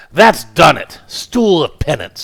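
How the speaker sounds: a bulldog of a man laying down the law; booming husband. Acted. Husband punishes his wife, booming.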